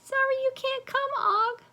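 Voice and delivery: very high, tiny squeak; squeaky hamster voice. A narrator's reading. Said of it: "I squeaked".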